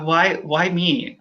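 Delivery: slow choked repeat. Real, not acted. Candidate repeating her question, slow and near tears. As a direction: let the why stretch out, close to tears. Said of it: "I'm choking up".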